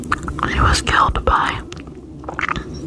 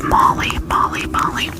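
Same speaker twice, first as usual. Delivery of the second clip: tingly asmr mouth sounds. Acted. Trigger word repetition.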